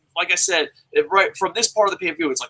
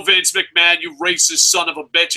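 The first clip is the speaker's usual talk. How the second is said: normal talking voice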